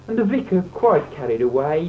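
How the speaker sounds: normal talking voice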